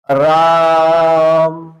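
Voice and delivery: राम with the आ held very long; राम खींचकर बोलो; drawn-out राम. Shown as a demonstration. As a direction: stretch राम, hold the long आ. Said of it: "जब आप लिखा देखते हैं".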